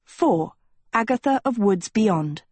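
ordinary delivery

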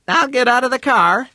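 high bright female voice; woman's voice. Acted. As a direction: lift into a high lady's voice. Mrs Lane voiced high.

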